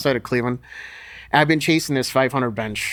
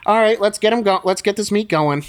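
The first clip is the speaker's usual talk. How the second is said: higher, louder, hurrying everyone along; impatient coach voice; 8 semitones higher, 5 dB louder than usual. Retold or imitated. Lou pushing to get the meet started, impatient loud call. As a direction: bark at the lifters to get the meet moving. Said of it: "Lou saying".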